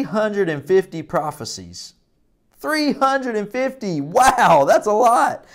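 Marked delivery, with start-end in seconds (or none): 2.6-5.4 put-on high, wide-eyed amazement for children; broad singsong kids theatre. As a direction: gasp it out like a kids' show host. Teacher wows kids at number.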